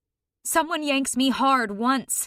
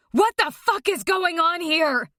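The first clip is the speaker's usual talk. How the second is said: yelling mother; 7 semitones higher than usual. A narrator's reading. Mother bursting into the room, yelling in outrage. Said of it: "she yells".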